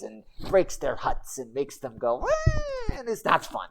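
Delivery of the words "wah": shrill high yelp of a wild monkey; monkey war cry. Acted. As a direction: let out a sudden high monkey screech. Monkey hurling rocks at goblins.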